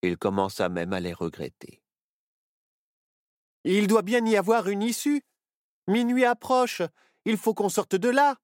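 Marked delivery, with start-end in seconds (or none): 3.6-8.4 higher, urgent panicky voice; panicking. A narrator's reading. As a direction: push for a way out in alarm. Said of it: "s'affola Nao".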